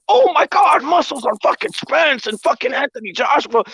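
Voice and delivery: hyped-up fanboy imitation; mock fan hype. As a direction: gush like an overexcited fan, mockingly. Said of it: "they're like".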